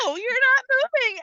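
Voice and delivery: high, whiny mock wail over a body; mock distress. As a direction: ham up the shocked victim. Victim mourning over dead body.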